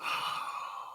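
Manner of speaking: soft, breath-only sigh; heavy sigh. Real, not acted. Asked about the crocodile CGI, long soft sigh. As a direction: let out a sigh.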